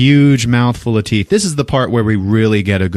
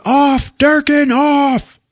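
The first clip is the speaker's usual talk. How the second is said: very high shouted command; imitated urgent yell; much slower than usual. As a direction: holler urgently to switch it off. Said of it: "then Stone yells out".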